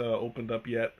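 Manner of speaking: usual speaking voice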